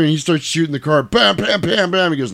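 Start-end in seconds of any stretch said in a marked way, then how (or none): 1.0-2.1 high, punchy spoken gunfire; imitated gunshots. Retelling him shooting the car, imitated gunfire. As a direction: fire the words off like shots.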